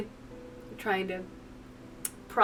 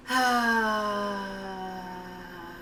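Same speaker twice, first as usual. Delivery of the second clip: long, falling sigh; 5 dB quieter than usual. Real, not acted. After encouraging Jill through another route, sighing. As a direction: let out one long breath. Sighs after the pep talk.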